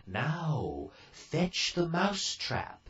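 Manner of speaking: high, slow, kindly fairy voice; fairy godmother. A narrator's reading. Fairy godmother giving Cinderella orders, high and slow. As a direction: lift the voice high and take it slowly.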